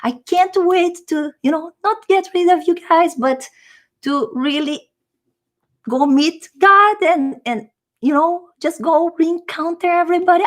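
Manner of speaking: grandma in her hospital bed, giddy about heaven; excited grandma. Pretend. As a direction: play the dying grandma eager to meet God. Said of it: "And she's like".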